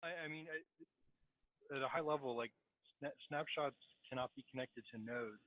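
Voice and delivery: faint, far-off voice; very quiet bad headset audio; barely audible. Real, not acted. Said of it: "your voice is very low".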